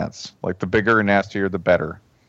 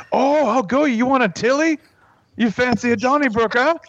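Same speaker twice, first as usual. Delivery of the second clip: much higher Canadian brawler impression; mock a Canadian challenge, pitched way up; Letterkenny Canadian accent. Letterkenny character starting a fight, Canadian accent. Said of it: "in Letterkenny, you know how they'll say things like".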